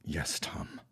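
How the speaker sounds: soft, unvoiced vow; a breathless, grave yes; hushed whisper. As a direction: whisper your assent, shaken. Agreeing to join the plot.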